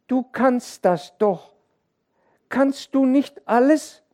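sehr hohe, langsame Kinderstimme; laut und kindlich zum Vater sprechen; bittendes Kind. A narrator's reading. (kindliches Bitten)

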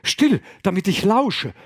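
loud, high urgent command; urgent hush. Acted. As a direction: demand silence, agitated. Abbot hushing fishermen, excited.

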